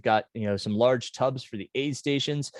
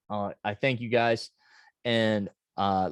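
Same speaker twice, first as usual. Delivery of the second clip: normal talking voice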